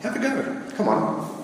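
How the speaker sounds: normal talking voice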